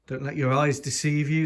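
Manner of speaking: ordinary talk, nothing marked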